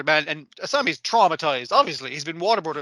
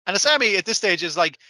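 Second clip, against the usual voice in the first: normal talking voice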